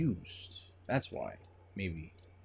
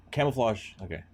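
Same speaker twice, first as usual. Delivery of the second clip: usual speaking voice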